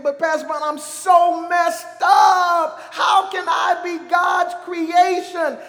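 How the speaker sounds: loud, high, drawn-out wail of protest; anguished shout, stretching the words; distressed churchgoer. Acted. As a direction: cry out in doubt to the pastor. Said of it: "I know what you're thinking".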